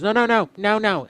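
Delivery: high, loud string of no's; a frantic coworker stopping him; high and loud alarmed no no no; coworker panicking. Retold or imitated. Coworker voiced refusing.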